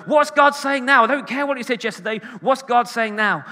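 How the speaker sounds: high, loud, urgent demand; eager prophetic type. Acted. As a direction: play an impatient prophecy enthusiast. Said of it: "prophetic people, just wanna know".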